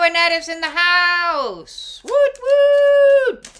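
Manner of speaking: high, loud cheer; hyped greeting. Real, not acted. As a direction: whoop it up like a hype host.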